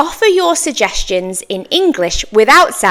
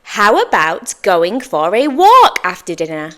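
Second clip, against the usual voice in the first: suggestion with a lifted pitch on "walk"; raised questioning tone; 2 semitones lower than usual. Shown as a demonstration. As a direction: offer it almost as a question, lifting "walk". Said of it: "I change the pitch and tone of my voice".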